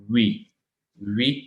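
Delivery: oui said exactly like English "we"; we, as the sound of oui. Shown as a demonstration. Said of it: "जो English में वी लिखते हैं". (oui का उच्चारण "वी")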